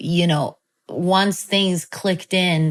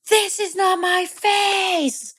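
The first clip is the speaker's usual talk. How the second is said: intense imitated yell; 13 semitones higher, slower than usual. Child yelling at mom, imitated high intense yell. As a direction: re-enact a kid yelling at mom, words stretched out. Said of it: "I'm just yelling so intensely at my mom".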